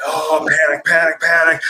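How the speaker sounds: hammed-up alarm, climbing; mock panic. Dad hamming up panic over his missing daughter.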